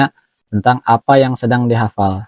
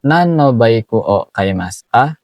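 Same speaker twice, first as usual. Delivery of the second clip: slow, careful teacher demo of the Japanese; slow model reading. Shown as a demonstration. Teacher modelling the Japanese question for students, slowly. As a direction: spell out each word slowly for learners.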